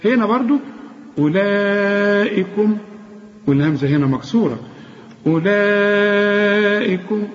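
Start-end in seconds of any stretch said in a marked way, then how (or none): 1.2-2.8 أولئكم with its long vowel held slowly; slow chanted أولئكم; long madd. Shown as a demonstration. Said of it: "وعندك مد أربع حركات". 5.3-7.3 full tajweed chant; recitation. Shown as a demonstration. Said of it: "مد أربع حركات".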